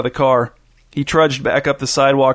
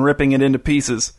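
usual speaking voice

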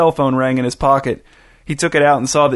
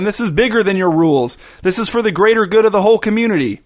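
high, impassioned declaration; heated, pitched-up narrator's shout; shouted tirade; 7 semitones higher than usual. A narrator's reading. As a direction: climb higher and faster into a rant. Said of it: "shouted Irvin".

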